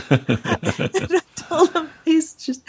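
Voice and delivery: laughing through the words, high. Real, not acted. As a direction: giggle out the words slowly. Laughing while retelling.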